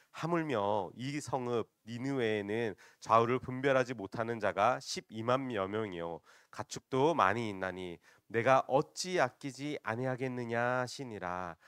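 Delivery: loud, slow, measured Bible reading; scripture reading. Real, not acted. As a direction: lead the congregation reading aloud. Congregation reads scripture aloud together.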